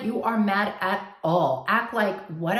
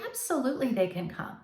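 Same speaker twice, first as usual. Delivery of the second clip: gracious agreement; 2 semitones higher, 6 dB quieter than usual. Pretend. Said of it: "I'm gonna challenge you to say".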